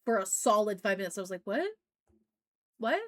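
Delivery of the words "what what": playing dumb. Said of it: "pretended that I didn't know".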